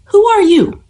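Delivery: high, frightened question; terrified. A narrator's reading. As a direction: ask it in panic. Terrified mother on phone.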